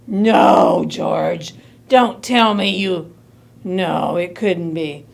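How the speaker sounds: low-pitched, halting dismay; slowly sinking horror; dreading disbelief. Acted. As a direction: refuse to believe it, voice dropping. Mother dreads what was swallowed.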